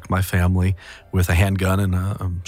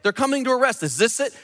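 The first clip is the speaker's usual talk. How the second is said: raised, impassioned preacher voice; preaching; 12 semitones higher than usual. Acted. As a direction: preach it with fire from the pulpit. Preaching a Bible passage.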